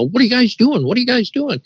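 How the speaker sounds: put-on high voice, normal volume; imitated wife. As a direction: mimic a wife asking what they are up to. Voicing wife coming out back door.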